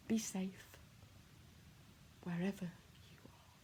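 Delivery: soft breathy whisper, barely voiced. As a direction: let it fade to a whisper. Repeated line, whispered farewell.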